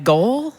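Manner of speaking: "Goal?" with a rising question tune; unsure ref calling "Goal?" as a question. Retold or imitated. Ref calling a goal, lifting "Goal?" like a question. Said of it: "he says it like a question".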